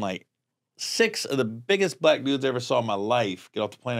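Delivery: normal talking voice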